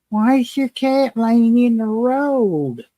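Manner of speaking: meth head voice. Retold or imitated. Meth head asking about the cat in the road, slow dazed voice. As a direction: drag it out like a confused meth head. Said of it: "this meth head comes over and goes".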